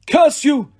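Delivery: sharp high cry of pain and rage; cried out. A narrator's reading. Wounded monster crying a curse at the archers. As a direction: spit the curse out at the unseen archer. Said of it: "Thorne cried".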